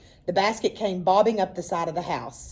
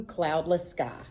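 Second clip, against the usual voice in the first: plain reading voice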